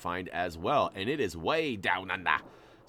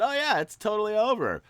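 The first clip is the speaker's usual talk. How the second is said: higher, louder fake cheer; mock sarcastic reassurance; 11 semitones higher, 7 dB louder than usual. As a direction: sell a fib you know is false.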